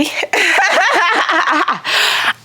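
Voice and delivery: long high cackling laugh; delighted squealing laugh that falls away; overjoyed laugh. Real, not acted. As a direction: burst into high, giddy laughter.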